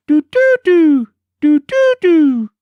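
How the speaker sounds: high, loud piping bird cry; bird call. A narrator's reading. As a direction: pipe it out like a bird. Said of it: "The sound it uttered was".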